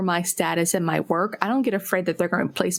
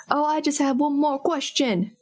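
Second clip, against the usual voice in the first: higher-pitched, badly faked British; bad stage-British, pitched up; terrible British accent; 6 semitones higher than usual. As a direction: attempt a British accent and miss. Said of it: "that was my really terrible British accent".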